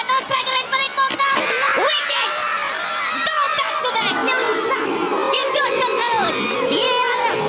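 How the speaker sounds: high shouted wicket call; excited cricket commentary. Acted. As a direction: celebrate the wicket on air.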